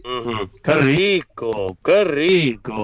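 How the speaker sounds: muy lento, alargando las vocales con deleite; saboreando el plato. Real, not acted. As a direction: relamerse con cada palabra, grave y pausado. Saborea el plato que le describen. (deleite lento y estirado)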